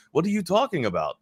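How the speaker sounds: puzzled question in a mock French accent; French-Canadian accent. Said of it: "he's French Canadian".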